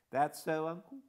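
lightly voiced, courteous and firm; respectful young man. A narrator's reading. Young man addresses Hannay respectfully, lightly voiced. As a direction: politely set the officer straight.

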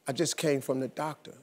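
low, soft, ominous news; something wrong. Retold or imitated. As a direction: imitate a troubled wife, voice sunk low. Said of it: "in her voice something was wrong".

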